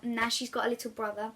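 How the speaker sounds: plain reading voice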